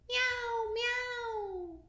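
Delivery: very high meow meow; cat meow. Acted. Reading to a child, a high meowing cat. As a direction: mew like a little cat. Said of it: "And the cat said".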